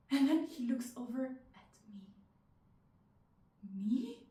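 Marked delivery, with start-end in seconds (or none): 3.8-4.2 high, rising questioning squeak; disbelieving surprise. Acted. Woman noticed by the dancer across the club, disbelieving surprise. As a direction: ask it as if he can't mean you. He looks over at her.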